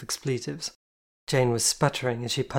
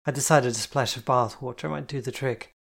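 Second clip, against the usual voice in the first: usual speaking voice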